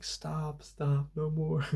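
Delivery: mild mock yell of a heckler, slowing at the end; a half-hearted shout from the crowd, begging it to stop; retold crowd yell. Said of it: "a woman in the crowd yelling".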